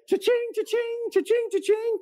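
high sing-song cha-ching like a till; cash register ringtone. Retold or imitated. Buddy's ringtone, mimicked as a high sing-song cash register chime. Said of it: "like, a cash register going off".

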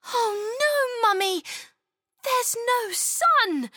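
put-on high, wailing little-girl dismay; a child crushed by the grey sky; disappointed exclamation. Chloe wailing to her mum, high and dismayed. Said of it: "Chloe exclaimed".

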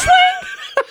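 squealing high burst of laughter; shrieking laugh. Real, not acted. Laughing at zooming phone.

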